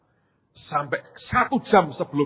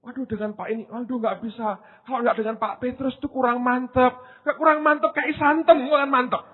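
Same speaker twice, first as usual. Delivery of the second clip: mock whining congregant; 11 semitones higher, 3 dB louder than usual. Imitating a congregant wanting Petrus.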